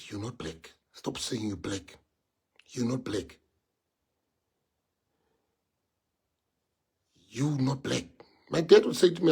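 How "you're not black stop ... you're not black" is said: a low, soft, firm father voice; stern father. Retold or imitated. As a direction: quietly lay down the law as his father. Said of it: "One day my father said".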